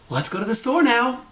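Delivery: high-pitched eager burst; excited, quick. Shown as a demonstration. Speaker demonstrating how tone turns a sentence positive, excited. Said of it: "very excitedly and very quickly".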